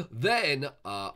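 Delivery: ordinary talk, nothing marked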